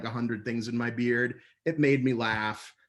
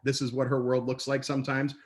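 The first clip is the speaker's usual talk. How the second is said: ordinary delivery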